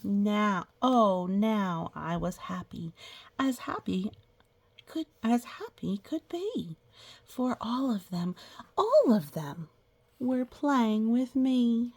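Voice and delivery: slow, drawn-out storybook reading; reading to children. Acted. Teacher reading a picture book to the class, storybook voice. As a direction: read it like a picture book for little kids.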